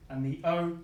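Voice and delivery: normal talking voice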